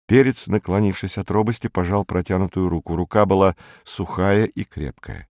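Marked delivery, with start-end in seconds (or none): none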